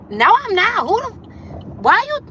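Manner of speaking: indignant retort. Retold or imitated. Snapping back at people who call her mad. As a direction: snap back, offended and heated.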